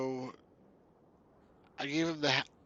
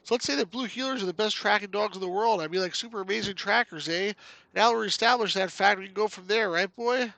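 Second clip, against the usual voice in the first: higher-pitched, folksy Canadian game character; chatty Canuck game hero; 8 semitones higher, 8 dB louder than usual. Acted. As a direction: play a chirpy Canuck explaining themselves, eh.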